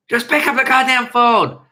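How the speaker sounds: loud, high imitation of someone demanding a call; shouted impression. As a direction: bark the order as someone else. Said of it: "who knows who I'm trying to imitate".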